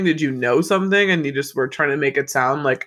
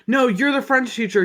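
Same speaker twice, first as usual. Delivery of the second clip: mock insisting; 8 semitones higher than usual. Acted argument between teachers.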